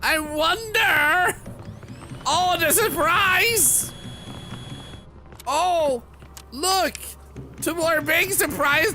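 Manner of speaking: high, loud exaggerated wonder with stretched words; hammed-up, squealed astonishment; mock surprise. Streamer feigning surprise at poop emotes, high loud mock shock.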